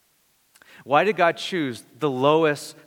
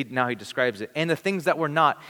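usual speaking voice

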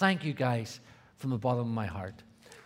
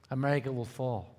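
usual speaking voice

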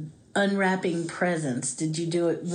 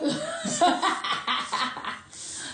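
high laugh. Real, not acted. Laugh after movie quote.

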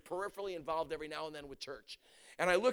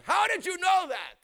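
crying out; 12 semitones higher, 10 dB louder, slower than usual. Retold or imitated. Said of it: "She starts crying".

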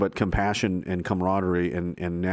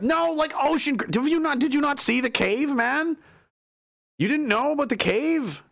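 high, mock-outraged rant; mock indignant captive; 11 semitones higher than usual. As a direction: rant like a man kidnapped to a cave. Playing a captive in a cave.

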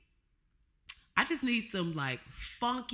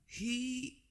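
sultry; 5 semitones higher, 4 dB quieter, much slower than usual. Real, not acted.

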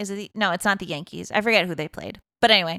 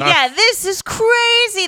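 high, loud sportscaster excitement; mock announcer; 13 semitones higher, 6 dB louder than usual. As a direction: call it like a stunned commentator. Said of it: "The announcer's like".

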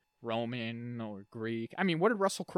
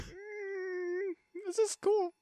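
much higher, squeaky mock approval; mock approving; much slower than usual. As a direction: pretend to be impressed. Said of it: "You'd be like".

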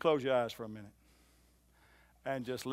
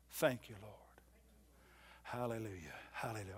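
whispered thanks; 4 semitones lower, 11 dB quieter than usual. Real, not acted. Whispered prayer after confession.

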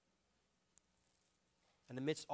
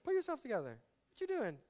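mocking impatience. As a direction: scoff at her tears, pitched up. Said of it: "It's like".

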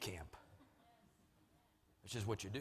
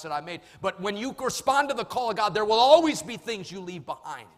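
loud, raised-pitch pulpit declaration; forceful preaching; 7 semitones higher, 11 dB louder than usual. Real, not acted. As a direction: build to a booming sermon climax.

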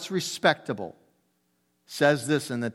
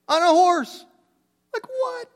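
incredulous; 4 dB louder, slower than usual. Real, not acted. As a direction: pitch leaps high in disbelief. Preacher astonished at the rabbi.

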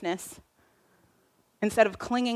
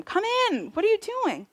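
pleading father; 9 semitones higher, 3 dB quieter than usual. Acted. Father pleading with older son.

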